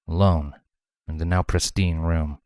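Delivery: ordinary delivery, nothing marked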